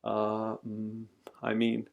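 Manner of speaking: soft, slow stammer; sheepish hesitation. A narrator's reading. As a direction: hedge quietly, searching for words. Father caught out, hesitates.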